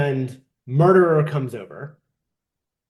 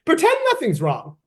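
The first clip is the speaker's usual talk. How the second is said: mock cheerful; 10 semitones higher than usual. Mocking the friend's reply.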